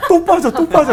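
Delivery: high, loud laughing outburst; laughing exclaim. Real, not acted. Laughing at the classic question. (웃음 터진 높고 큰 외침)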